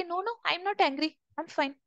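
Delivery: acting out a touchy friend who insists all is well; passive-aggressive denial. Upset friend brushing off a worried question, softly acted denial. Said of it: "if you ask them, they'll say".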